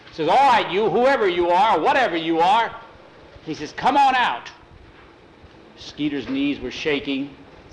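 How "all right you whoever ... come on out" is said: loud, higher calling out; loud brave call; bold challenge. Acted. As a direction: call into the dark to sound brave. Boy challenges hidden figure.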